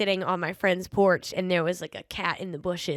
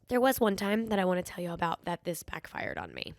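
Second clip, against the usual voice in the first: ordinary delivery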